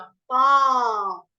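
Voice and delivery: पा loud and held long. Shown as a demonstration. Teacher shows the vowel sign, loud drawn-out पा. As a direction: stretch and swell the aa of पा. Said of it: "आ की मात्रा".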